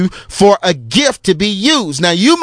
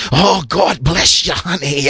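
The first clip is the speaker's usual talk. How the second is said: sexy preacher voice; 4 semitones lower than usual. Retold or imitated. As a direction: coo it like a smooth preacher flirting.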